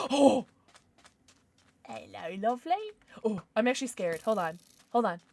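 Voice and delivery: ordinary talk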